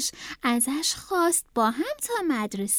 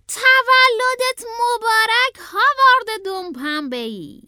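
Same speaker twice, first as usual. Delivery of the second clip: high, slow birthday cheer at full volume; loud announcement; 9 semitones higher, 7 dB louder, slower than usual. A narrator's reading. Uncle presenting Howard's birthday cake, loud high cheer. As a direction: call it out loud and drawn out. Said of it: "با صدای بلند گفت".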